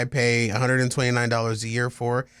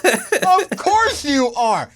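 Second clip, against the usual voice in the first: laughing; 10 semitones higher, much slower than usual. Real, not acted. Laughs at friend's pivot.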